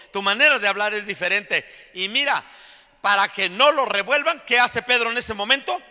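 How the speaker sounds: high, loud preaching at full force. Real, not acted. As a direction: belt it out to the whole congregation. (predicación a todo pulmón, con voz alta)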